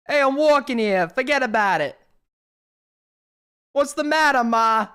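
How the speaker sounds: put-on loud, broad New York street accent; mock Brooklyn tough guy calling out. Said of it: "I'm from New York".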